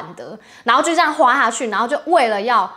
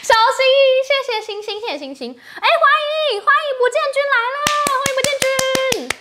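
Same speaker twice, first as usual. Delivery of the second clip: excited greeting; 13 semitones higher than usual. Real, not acted. Greeting viewers on stream.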